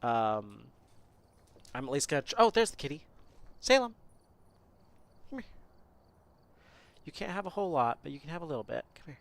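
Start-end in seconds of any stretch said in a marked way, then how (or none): 3.6-5.5 high sing-song call; calling the cat. Real, not acted.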